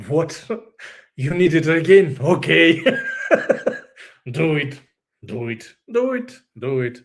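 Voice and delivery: an exasperated God waving her on, half laughing. Retold or imitated. Speaker voicing God facepalming at her relapses, exasperated.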